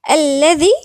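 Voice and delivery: tongue tip between the teeth for the ذ in الذي; interdental dhal. Shown as a demonstration. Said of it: "الذال هنا من الحروف اللثوية".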